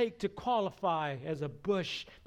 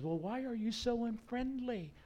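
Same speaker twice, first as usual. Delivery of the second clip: high-pitched, puzzled question; mock hurt; 7 semitones higher than usual. Bush questions the irritated Moses, high mock-hurt voice. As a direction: ask it high, as if put out by the rebuff.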